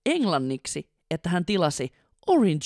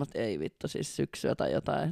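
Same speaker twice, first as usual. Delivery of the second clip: muttered realisation; 3 semitones lower, 8 dB quieter than usual. Real, not acted. Realising own mistake, muttered aside.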